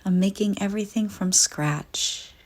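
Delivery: normal talking voice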